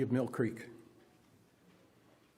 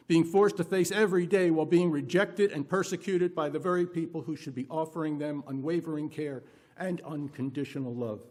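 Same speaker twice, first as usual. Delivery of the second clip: usual speaking voice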